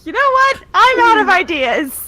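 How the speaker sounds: loud, high-pitched giving up; real frustrated yell, slowing at the end; exasperated outburst. Real, not acted. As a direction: throw up your hands and shout it. Gives up after plan is mocked.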